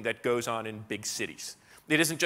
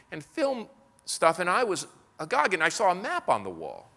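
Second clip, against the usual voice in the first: higher, eager and bubbling over; retelling a colleague who raves about the studio, pitched higher; excited colleague; 6 semitones higher than usual. Retold or imitated. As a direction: gush like a thrilled new recruit showing off. Enthusiastic colleague describing studio hires.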